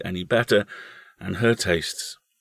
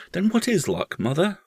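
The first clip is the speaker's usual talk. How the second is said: put-on higher-pitched child's questioning; curious little boy, high voice; young boy; 11 semitones higher than usual. A narrator's reading. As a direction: lift the voice into a small boy's. Boy questions his mother.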